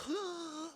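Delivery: sharp high mock gasp of shock. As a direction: gasp like the whole cast is scandalized. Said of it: "And everyone's like".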